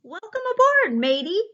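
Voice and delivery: high, loud hearty pirate shout. Acted. Captain Delbert shouting a welcome to his friend, loud and hearty. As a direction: boom a jolly welcome. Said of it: "Captain Delbert shouted".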